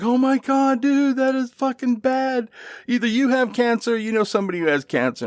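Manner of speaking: high, shocked "oh my God, dude"; shocked disbelief. Retold or imitated. Poker buddy reacting, appalled by the cancer joke, shocked disbelief. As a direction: react like the joke went way too far.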